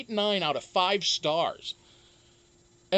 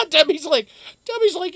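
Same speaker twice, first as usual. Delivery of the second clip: high, loud laughing delivery; 11 semitones higher, 10 dB louder than usual. Real, not acted. Streamer cracking up at a viewer's comment. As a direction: laugh through the words.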